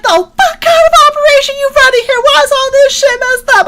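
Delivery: very high mock yell broken by laughter; screaming sergeant. Sergeant screaming at the dorm chief, laughing mock yell. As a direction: imitate her screaming while cracking up. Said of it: "And she's screaming at me".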